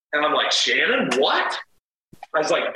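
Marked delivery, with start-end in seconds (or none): 0.5-1.6 baffled, voice jumping higher; acting out incredulity; retold disbelief. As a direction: rise sharply on a stunned question. Retelling his baffled reaction to accusation.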